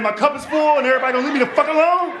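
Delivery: loud, high disbelieving question; incredulous shout. Acted. Incredulous protest to the doctor.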